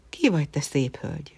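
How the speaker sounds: ordinary delivery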